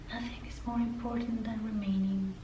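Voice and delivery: gently lilting mock-spiritual quote; mock new agey. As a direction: say it like a hushed, dreamy self-help slogan. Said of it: "like, it's new agey".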